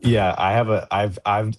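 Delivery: usual speaking voice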